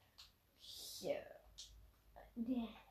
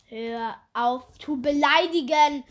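louder, slow, firm scold; loud telling off; 9 dB louder than usual. Real, not acted. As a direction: tell him off firmly. Telling friend off loudly.

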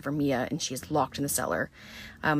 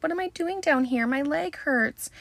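high, small mock-crying voice; 10 semitones higher than usual. Mia crying to Natalie from the cellar, high and tearful. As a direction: whimper it like a hurt, scared girl. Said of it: "she's, like, crying".

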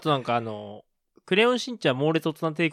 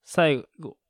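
normal talking voice

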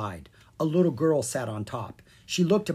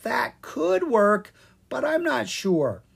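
high, slow, uneasy pixie voice; hesitant little pixie; troubled pixie; 9 semitones higher, 3 dB louder, slower than usual. A narrator's reading. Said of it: "Sprite looked troubled".

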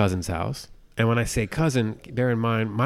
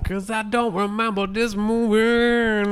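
high, louder, slow parody; squeaky teasing copy of his words; mock him in a silly high voice; mocking echo; 13 semitones higher, 5 dB louder, slower than usual. Echoed in mock voice.

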